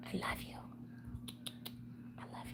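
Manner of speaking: soft whispered love to a pet; whispering. Real, not acted. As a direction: whisper it lovingly. Whispering to her dog.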